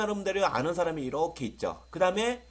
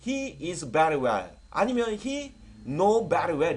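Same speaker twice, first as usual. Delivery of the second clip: slow model English; 4 dB louder, much slower than usual. Shown as a demonstration. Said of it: "이렇게 쓰시면 돼요".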